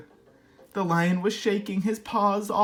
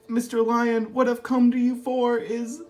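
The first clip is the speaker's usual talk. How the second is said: ordinary delivery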